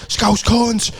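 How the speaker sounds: high mock jeers; hammed-up crowd taunts; jeering crowd. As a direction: heckle like a rowdy rugby crowd. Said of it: "people just going".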